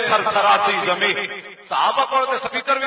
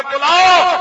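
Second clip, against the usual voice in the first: higher, louder summoning cry; call out; 5 semitones higher, 5 dB louder, slower than usual. Pretend. Preacher voicing a solemn summons, higher and louder. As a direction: summon them with authority.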